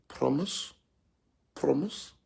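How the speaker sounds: small, soft questioning voice; softened wife. Retold or imitated. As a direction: ask quietly, wanting to believe him. Acted wife softening, seeking promise.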